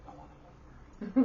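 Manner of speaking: put-on high-pitched little kid voice; childish, high plea; little poor kid. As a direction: pipe up like a kid wanting an outing. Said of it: "I was a little poor kid".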